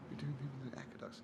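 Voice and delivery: very soft imitation of a child answering; barely audible mumble. Daughter answers teacher at parents' day, barely audible. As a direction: say it too quietly to be heard. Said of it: "Sorry, darling, I can't hear you".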